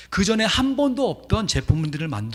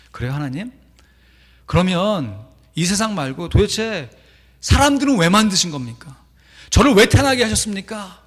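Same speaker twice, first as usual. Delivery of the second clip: louder and higher, building to an anguished cry; complaining to God; 5 semitones higher, 8 dB louder than usual. Retold or imitated. Carver crying out to God in despair, building louder. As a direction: demand why you were born, rising.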